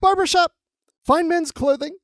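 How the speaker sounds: high, loud, excited cartoon shout; Boimler. Podcaster quoting Boimler crashing through the shops, high excited shouting. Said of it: "Boimler's like".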